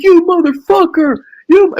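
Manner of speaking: high loud imitated outburst; high shouted imitation of rage; mock furious caller. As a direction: blow up at him, shrill. Caller exploding at the prank.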